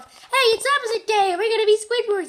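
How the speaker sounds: high, bouncy cartoon voices; goofy cartoon kids. SpongeBob and Patrick teasing Squidward, high and giddy. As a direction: squeak it out like giddy kids playing pretend. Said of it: "they were like".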